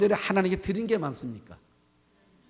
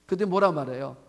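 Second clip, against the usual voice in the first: ordinary delivery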